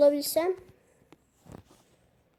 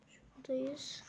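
whisper; 9 dB quieter, slower than usual. Real, not acted. Quiet aside while navigating.

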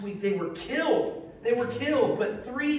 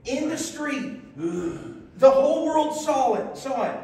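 louder, higher and slow; preacher thrilled by the story; excited; 3 semitones higher, 5 dB louder, slower than usual. Real, not acted. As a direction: build the wonder as you tell it. Preacher builds on the resurrection.

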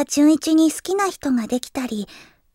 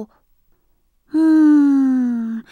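long, drawn-out pondering "hmm"; pensive hum; 4 semitones lower, much slower than usual. Acted. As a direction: hum slowly while mulling it over. 悩んで考え込む独白. (長く伸ばす悩みの「うーん」)

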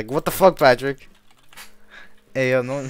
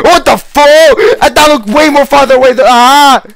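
panicked scream; 10 semitones higher, 13 dB louder, slower than usual. Real, not acted. Startled by a giant cockroach.

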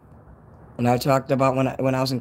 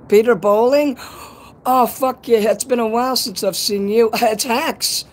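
much higher, louder, thrilled greeting; excited; 10 semitones higher, 5 dB louder than usual. Real, not acted. Excited greeting of old viewers.

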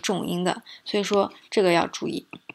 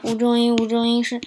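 "无中音" on one held, slightly raised note; toneless; 3 semitones higher, 4 dB louder than usual. Shown as a demonstration. Teacher showing lazy unstressed speech, toneless monotone. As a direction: drone "无中音" evenly, with no tones. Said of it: "那这个听起来有气无力".

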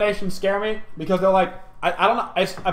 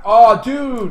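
excited; 5 semitones higher, 6 dB louder than usual. Real, not acted. Sudden exclamation.

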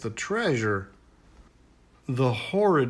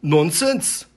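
gruff chief, louder, dismissive; stubborn chieftain; 5 semitones higher, 7 dB louder than usual. A narrator's reading. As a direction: brush off the girl as the know-it-all chief. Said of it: "the chieftain replied".

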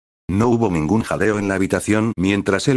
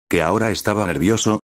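usual speaking voice

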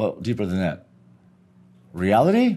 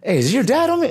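street brother; 9 semitones higher than usual. Retold or imitated. Brother telling the stranger to back off, high pushy voice. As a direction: play the hustler brushing him off. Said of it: "And this brother".

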